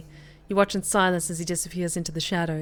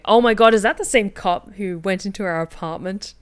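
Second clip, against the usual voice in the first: surprised; 6 dB louder than usual. Real, not acted. Streamer recognizes the cop.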